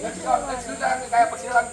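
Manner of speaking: ordinary talk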